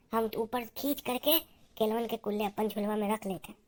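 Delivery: softly urging an accomplice on; conspiratorial hush. Acted. Secretly directing the banana theft.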